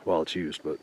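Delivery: normal talking voice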